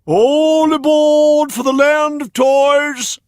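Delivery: very high, loud, slow call; coachman calling. A narrator's reading. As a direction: sing out over the crowd. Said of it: "called down".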